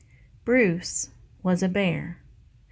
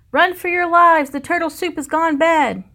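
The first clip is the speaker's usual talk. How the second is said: much higher and louder frantic shout; panicked yell; 10 semitones higher, 9 dB louder than usual. Acted. Kitchen mice warning everyone to flee, panicked shout. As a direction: scream a warning as the kitchen falls apart.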